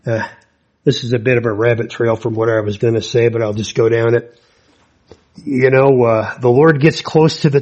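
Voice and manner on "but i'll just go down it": ordinary talk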